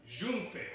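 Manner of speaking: "Junpei" repeated as zhoom-pay; soft zh opening on "Junpei", ending in pay; corrected name pronunciation. Acted. Runner corrects the announcer, corrected name pronunciation. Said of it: "my name is pronounced Junpei".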